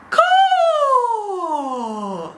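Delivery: high, piercing bird cry; posh eagle caw. Shown as a demonstration. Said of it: "they called it the posh eagle".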